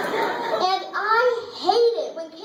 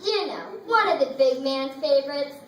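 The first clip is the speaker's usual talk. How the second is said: ordinary talk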